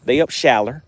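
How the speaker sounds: "shallow" said as shaller; R on the end. Shown as a demonstration. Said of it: "say it with an R on the end".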